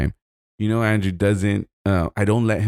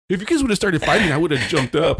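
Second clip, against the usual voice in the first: higher, defensive mock whine; mocking timid friend; 8 semitones higher than usual. Retelling the friend's excuse about the fight in a higher mock whine. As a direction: sneer it as his lame excuse. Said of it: "he's like".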